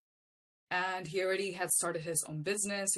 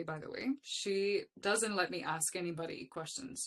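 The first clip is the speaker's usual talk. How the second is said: ordinary talk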